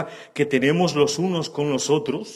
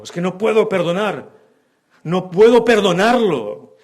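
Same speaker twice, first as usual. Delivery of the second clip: higher, louder stubborn refusal to forgive; indignant refusal; 4 semitones higher, 6 dB louder than usual. Retold or imitated. Unforgiving believer insisting he cannot forgive, loud. Mocking unforgiving person's excuse.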